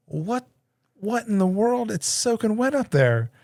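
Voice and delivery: high-pitched disbelief at the mess; dad staring up at the soaked ceiling, astonished; shocked dad. Retold or imitated. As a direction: react like you just saw the wet ceiling.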